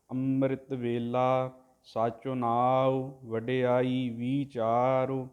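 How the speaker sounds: drawn-out devotional chanting, word by word; slow santhiya recitation of scripture; slow Gurbani recitation. Shown as a demonstration. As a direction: chant each syllable slowly and evenly, like a granthi. Teacher chants the Gurbani line.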